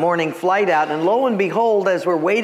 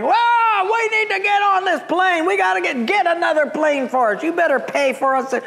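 high-pitched imitation of an angry mob; mock yelling; 13 semitones higher than usual. Two hundred stranded passengers yelling at the airline, mock yell. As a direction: holler like a whole crowd of complainers. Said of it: "two hundred people back are yelling".

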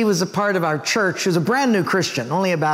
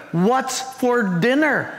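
higher, drawn-out question, each word spaced; slow, deliberate asking; 4 semitones higher, much slower than usual. Acted. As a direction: stretch it out as if for someone hard of hearing. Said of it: "right up next to her ear".

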